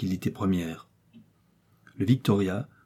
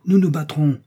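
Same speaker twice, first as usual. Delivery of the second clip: loud, raised fighting retort; exasperated; 6 semitones higher, 6 dB louder than usual. A narrator's reading. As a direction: declare it with exasperated force. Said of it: "répliqua le chasseur exaspéré".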